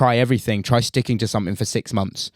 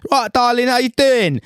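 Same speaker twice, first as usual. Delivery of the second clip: high, hammed-up Essex accent; bad Essex accent, way up in pitch; Gemma Collins Essex accent; 13 semitones higher, 5 dB louder, slower than usual. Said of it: "I'm gonna do a very bad Essex accent".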